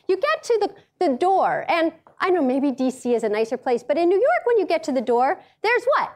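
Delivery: usual speaking voice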